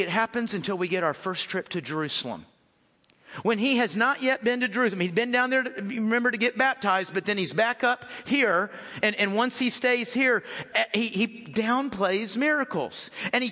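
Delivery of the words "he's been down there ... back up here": ordinary talk, nothing marked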